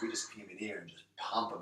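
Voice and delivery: hushed, soft and secretive; whispered. Retold or imitated. The bouncer confides to the new doorman in a whisper. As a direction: lean in and whisper it.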